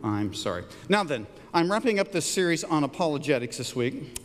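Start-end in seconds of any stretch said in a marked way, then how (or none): 0.0-0.6 low-pitched, understated apology; simple apology. Shown as a demonstration. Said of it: "is simply".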